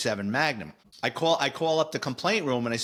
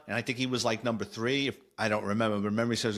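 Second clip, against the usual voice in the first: usual speaking voice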